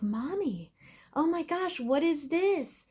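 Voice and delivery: lightly voiced excited little boy; mild kid voice discovering a treat; son's surprise. Mother voices her son's surprise.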